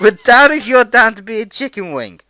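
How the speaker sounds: high, loud, goofy yell; loud silly shout. Pretend. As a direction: belt it out like a goofball. Repeats the silly line loudly.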